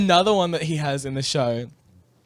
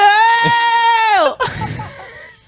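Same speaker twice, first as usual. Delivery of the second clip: a shrieking, helpless cackle of laughter; high shrieking laugh; 9 dB louder than usual. Real, not acted. Cracking up at her own story, squealing laugh.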